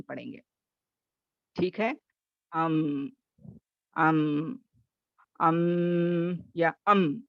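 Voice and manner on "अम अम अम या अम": "अम" then a slow, stretched "अम"; "अम" letter demo. Shown as a demonstration. Teacher contrasting ways to say "अम", slow. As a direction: contrast takes of "अम". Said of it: "ऐसे नहीं करेंगे".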